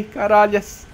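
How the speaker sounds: ordinary delivery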